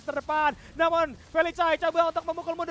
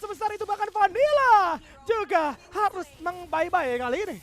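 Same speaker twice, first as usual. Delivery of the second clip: rising hype play-by-play; excited casting; 5 semitones higher, 4 dB louder than usual. Real, not acted. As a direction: build the call up fast and loud. Esports caster hyping a teamfight. (komentator esports yang makin heboh)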